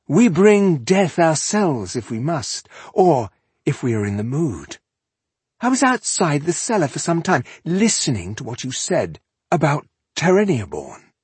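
conversational villain. A narrator's reading. Elf chatting to captives as at a reception, conversational. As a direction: chat pleasantly about death. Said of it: "His tone was conversational".

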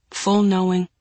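ordinary delivery

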